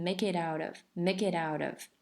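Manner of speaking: "make it out of" as one smooth word; linked words. Shown as a demonstration. Teacher having students listen, "make it out of" linked. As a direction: run "make it out of" together without plosive puffs. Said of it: "这样就连起来".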